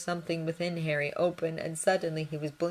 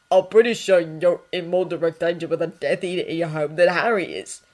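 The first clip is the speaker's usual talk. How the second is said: louder, alarmed blurt; 8 dB louder than usual. Acted. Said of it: "sounding alarmed".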